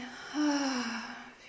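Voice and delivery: long, audible exhaled sigh; demonstrated sigh. Shown as a demonstration. Yoga teacher guiding a full exhale, long letting-go sigh. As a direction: breathe out fully with a drawn-out sigh. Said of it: "maybe with a sigh".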